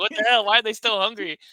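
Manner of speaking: high, loud, baffled outburst; mock outrage. Retelling Rick finding the hungry aliens, mock-outraged questioning. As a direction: demand it in exaggerated disbelief. Acted outburst of retold character.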